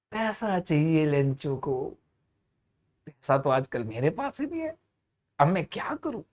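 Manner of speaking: worried musing. Acted. Postmaster, short of money, wondering aloud to himself, quiet and worried. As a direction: mutter it, wondering where the money will come from. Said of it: "ऐसे बैठे हुए".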